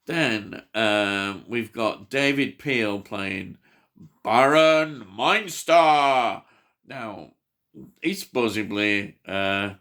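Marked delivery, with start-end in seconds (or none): 4.2-6.4 drawn out, loud and higher, theatrical flourish; grand announcement. Pretend. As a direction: proclaim the villain's name with hammy grandeur. Grandly announcing a character's name.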